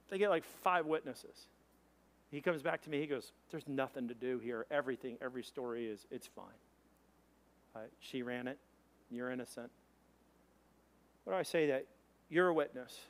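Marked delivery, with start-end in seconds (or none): 3.5-9.7 low, soft matter-of-fact verdict; retold officer, dropped low and hushed; quiet officer. Policeman tells driver he is innocent, low and quiet. Officer clears him privately.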